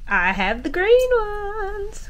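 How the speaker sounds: high, slow, delighted reveal; excited. Real, not acted. To viewers, showing the green holders, high and delighted. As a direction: show them off like a prize.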